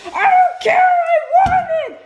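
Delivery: high, loud bratty wail; whiny tantrum. Real, not acted. Child tantrum over lost stamp.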